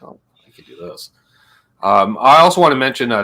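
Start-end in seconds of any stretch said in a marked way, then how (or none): 0.0-1.1 whispered aside. Retold or imitated. Quoting viewers, whispered.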